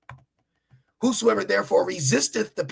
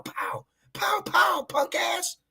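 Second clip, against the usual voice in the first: mock gunshot cries; 13 semitones higher, 4 dB quieter, much slower than usual. Mimics gunfire of soldiers.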